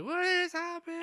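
very high mock wail; panicked kid. Kids in the classroom, mock panic. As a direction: squeal it like a scared child. Kids crying in real life.

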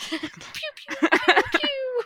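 high, breathy pew-pew popping noises; firecracker pops. Pretend. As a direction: pop out little firecracker sounds, laughing. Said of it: "Firecracker noise".

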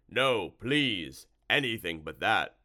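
loud, wailing creature plea; pleading monster. A narrator's reading. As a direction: beg loudly as a horrified monster. Monster begs for mercy.

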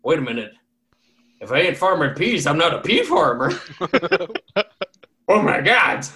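hammed-up old man shock; comic existential shock; shocked realization. Farmer realizes he isn't one.

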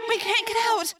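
high, distant cry for help; distressed cry. A narrator's reading. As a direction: cry out in a high, trapped girl's voice. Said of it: "Rachel cried".

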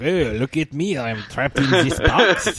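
hammed-up French Canadian accent; French Canadian Bergeron impression. Talkative mime to an audience, French Canadian accent. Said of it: "does not sound like that".

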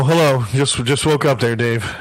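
usual speaking voice

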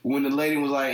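usual speaking voice

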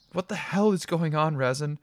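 frightened. A narrator's reading. Frightened girlfriend after blood sign.

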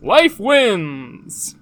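loud, booming announcer-like cry; failed tree creature impression; Maokai attempt. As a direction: proclaim it big and drawn out. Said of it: "that's not a Maokai voice".

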